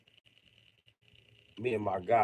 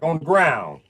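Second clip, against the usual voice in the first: loud retort; 7 semitones higher, 10 dB louder, slower than usual. Retold or imitated. Kid answering where to roll.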